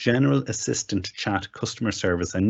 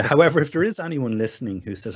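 ordinary talk

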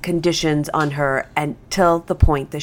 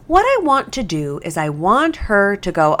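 ordinary delivery

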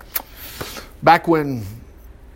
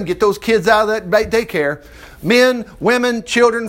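ordinary delivery

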